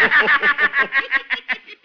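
loud embarrassed chuckle; loud sheepish chuckle; sheepish laugh. Acted. Professor laughs at himself.

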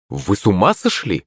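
ordinary delivery

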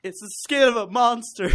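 high, loud melodramatic whine; over-the-top tragic vampire line; mock weepy vampire. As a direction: ham up a tortured vampire. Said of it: "crying and going".

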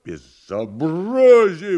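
slow, high, loud howl; yelling. Acted. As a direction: bellow in outrage. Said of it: "вдруг завопил Иван".

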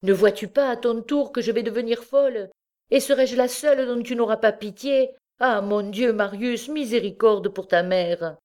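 high-pitched, distraught entreaty; desperate plea. A narrator's reading. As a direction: beg for pity as a distraught mother. Mother begs son for mercy.

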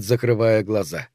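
plain reading voice

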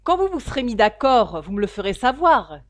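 loud mocking irony; ironic. A narrator's reading. Father mocks the departing suitors, loud and ironic. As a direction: sneer it loudly. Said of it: "dit-il ironiquement".

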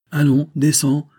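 sharp impatient command from a priest; imperious, impatient. A narrator's reading. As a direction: command him to get down, losing patience. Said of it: "d'un ton plus impérieux et avec une pointe d'impatience".

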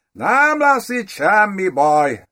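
high, slow affected count; kényeskedő idegen gróf. A narrator's reading. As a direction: reassure in a high, drawn-out foreign voice. Ulrich's affected voice returns. (magas, elnyújtott, kényeskedő hang)

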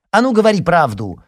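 high, loud insistence; pushy high-pitched shout; shouted demand. A narrator's reading. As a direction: demand an answer at full voice. Sister pestering brother loudly.

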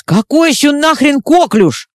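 high, loud indignant demand. Acted. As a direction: bristle at the call sign. Sanzha indignant on the radio.

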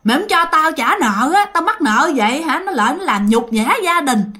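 mother yelling to guilt-trip her daughter; screaming threats. Retold or imitated. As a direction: wail it out as a desperate mother shouting at her daughter. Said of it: "bả mới la làng lên".

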